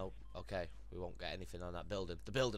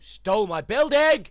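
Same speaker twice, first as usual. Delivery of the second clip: high, loud indignant shout; real outrage at the building thieves; outraged yell; 12 semitones higher, 21 dB louder than usual. Real, not acted. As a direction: shriek it at the thieves.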